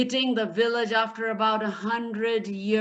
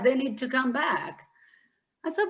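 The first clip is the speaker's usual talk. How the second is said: usual speaking voice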